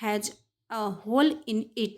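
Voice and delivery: halting, word by word; slow sentence reading. Shown as a demonstration. Teacher reading the whole and hole sentence, very slow. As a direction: let each word land for the class. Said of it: "इसका sentence देख लेते हैं".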